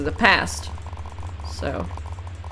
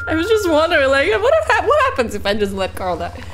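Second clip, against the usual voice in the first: laughing; 10 semitones higher, faster than usual. Real, not acted. Giggles asking about killing Carl.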